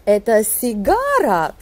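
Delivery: "cigara" leaps on GA, then drops; abrupt question rise. Shown as a demonstration. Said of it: "l'intonation monte brusquement". (question russe, « cigara » monte brusquement puis retombe)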